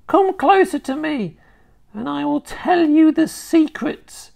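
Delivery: put-on high, sly old hag; coaxing witch. A narrator's reading. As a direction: tempt him closer. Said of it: "she said".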